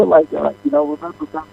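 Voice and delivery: faint voice, far from phone; talking away from the phone; phone held away. Real, not acted. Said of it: "I can't hear you".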